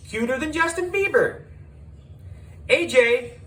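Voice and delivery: high, fast, pleading fan squeals; frenzied fans. Acted. Fans crowding A J begging for autographs, frenzied. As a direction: mob of starstruck kids begging.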